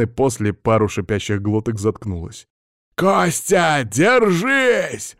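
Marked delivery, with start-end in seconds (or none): 2.9-5.2 put-on old man shouting to Kostya to hold on; booming shout. A narrator's reading. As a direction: yell encouragement across the fight. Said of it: "громогласно закричал он".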